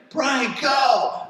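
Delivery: high, pushing shout; repeated shout from the lineup, high-pitched; urgent yell. Retold or imitated. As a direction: call him onto the wave again. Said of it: "He's like".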